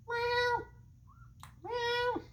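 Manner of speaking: high squeaky meow; tiny high-pitched cat mewing. Retold or imitated. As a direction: mew like a bored cat. Cat imitation.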